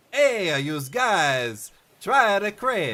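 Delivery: meant as French but comes out Italian; failed French accent. Acted. Applicant attempting a French accent for the crepe shop, sounds Italian. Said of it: "that still sounded pretty Italian".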